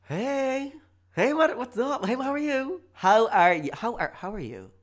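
fake nicety. Greeting someone who may have overheard, fake-cheerful. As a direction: gush with fake friendliness. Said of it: "real fake nicety".

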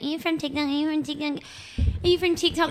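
high, repetitive mock fan voice; put-on eager fan chorus; imitating pestering fans. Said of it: "just being like".

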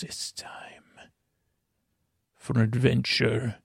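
hushed dramatic announcer, half whispered; breathy hushed trailer voice; Antonio Banderas show opener. Pretend. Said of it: "turn things over to Mister Antonio Banderas".

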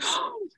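very high startled gasp; high shocked intake of breath; mock gasp. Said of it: "She was like".

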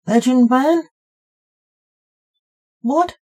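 high, rising puzzled question; baffled, pitch climbing on each question; confused. A narrator's reading.

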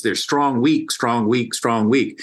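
chanted strong, weak in a steady beat; strong weak beat pattern. Shown as a demonstration. Teacher to podcast host, tapping out trochees with the words. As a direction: tick off the trochee like a metronome. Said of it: "in their trochees".

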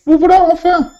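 high, loud woman's cry of relief; exclaiming widow. Acted. Widow greeting the officers, high and loud. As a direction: call out to the arriving officers. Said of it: "S'exclama madame Le Marchand".

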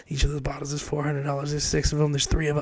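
hushed tallying. Acted. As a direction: count up the bill under your breath. Silently tallying bottle prices in dismay.

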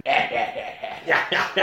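ordinary delivery

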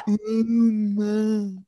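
strangled muffled Uber; mock underwater yell; dream scream. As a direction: try to yell but it won't come out. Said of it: "when you try and scream in a dream".

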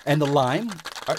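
normal talking voice

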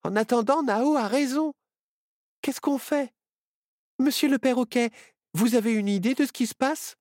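very high-pitched little character; high, eager owl voice; Myrtille's owl voice. A narrator's reading. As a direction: pipe up high with questions. Myrtille the owl's voice.

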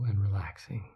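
gentle whispered cue; soft spa whisper. Acted. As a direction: whisper it to let them sink.

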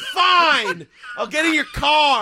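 loud, high huffy retort; mock exasperated giving-in. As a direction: imitate someone caving in angrily. Acted quote of defiant, yielding character.

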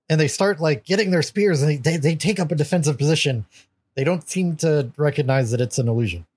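ordinary talk

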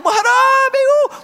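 put-on strut in and hail God like an old pal; swaggering greeting. Swaggering into the throne room.

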